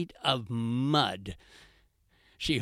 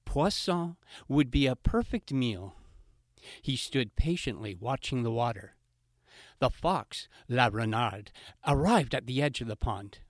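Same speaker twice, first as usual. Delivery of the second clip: plain reading voice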